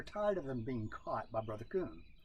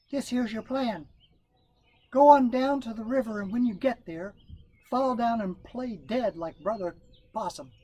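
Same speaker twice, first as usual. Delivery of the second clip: Brother Rabbit; 9 semitones higher, 5 dB louder than usual. Acted. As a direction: brag like the best planner around.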